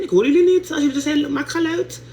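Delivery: loud high call to the crowd; calling out to audience. Real, not acted. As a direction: shout it out to the viewers, egging them on. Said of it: "Wees louder". (luid en hoog het publiek oproepen)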